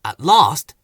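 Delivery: ordinary delivery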